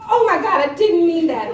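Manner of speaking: loud, high mock dismay; flustered protest. Client caught out by his chef, loudly flustered. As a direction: exclaim it high through laughter.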